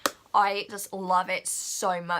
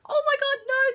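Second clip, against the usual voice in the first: alarmed; 5 dB louder than usual. Retold or imitated. Reliving Zac running into fire.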